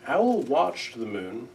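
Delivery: ordinary delivery, nothing marked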